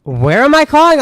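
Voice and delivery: high, loud demand on the phone; the manager barking down the line; stern boss. Retold or imitated.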